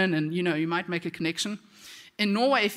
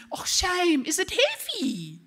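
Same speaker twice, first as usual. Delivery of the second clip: high, drawn-out teasing coo; mock sympathy; 11 semitones higher, slower than usual. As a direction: imitate a sister gleefully mocking with fake pity. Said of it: "my sister thinks this is hilarious".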